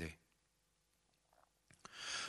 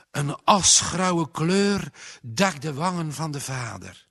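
ordinary delivery, nothing marked

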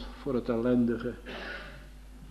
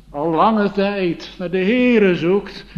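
earnest emphasis; 6 semitones higher, 9 dB louder, slower than usual. Acted. Preacher describing a seeking girl.

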